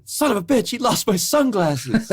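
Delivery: high-pitched mock outrage; Larry, freaking out. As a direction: imitate an indignant freak-out. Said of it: "Larry freaks out".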